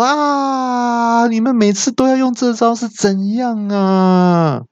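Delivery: high, wailing villain complaint; exasperated outcry. A narrator's reading. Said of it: "那恶怪听了就大叫说". (抓狂地高声大叫)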